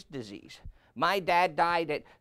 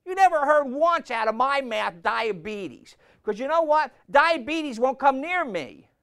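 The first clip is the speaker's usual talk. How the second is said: loud, high, driving sermon voice; preaching at full force; 8 semitones higher, 6 dB louder than usual. Acted. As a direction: hammer it home from the pulpit.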